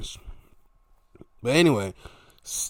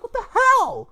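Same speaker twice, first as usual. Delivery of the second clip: high, loud incredulous cry; comic outrage; mock disbelief; 7 dB louder than usual. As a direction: exclaim it way up high, hammed up. Retold reaction to the push.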